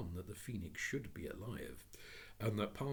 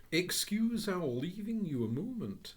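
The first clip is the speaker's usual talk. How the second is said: higher, slow polite; polite gentleman; 11 semitones higher, 7 dB louder than usual. Acted. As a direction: excuse himself to children. Gentleman excuses himself.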